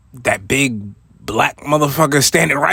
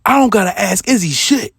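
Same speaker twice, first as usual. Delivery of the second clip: higher and louder, cutting him off; dismissive snap; 9 semitones higher, 6 dB louder than usual. Retold or imitated. The boss shutting down his manager's excuse, angry. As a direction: shut the excuse down hard. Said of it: "He said".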